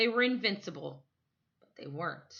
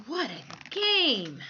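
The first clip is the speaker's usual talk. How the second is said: slow, drawn-out wonder; amazed thought; 6 semitones higher, 5 dB louder, much slower than usual. A narrator's reading. Michael marvelling at the game, slow and amazed. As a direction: savor it in disbelief. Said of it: "Michael thought".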